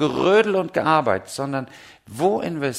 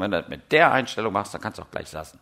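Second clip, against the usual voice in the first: dry remark; 6 semitones lower, faster than usual. Retold or imitated. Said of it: "ganz trocken".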